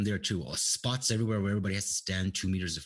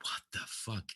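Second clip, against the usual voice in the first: a hushed what the fuck under the breath; retold whispered aside; 7 dB quieter, slower than usual. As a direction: mutter it to yourself, barely voiced. Retold muttered reaction in shop.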